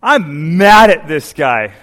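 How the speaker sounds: slow, loud hammed-up anger; mock mad declaration. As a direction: lean into the fake grudge. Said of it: "gets all worked up".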